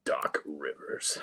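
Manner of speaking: slow, low raspy rasp. Pretend. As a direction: rasp it out slowly. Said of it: "You gotta talk with that raspy voice".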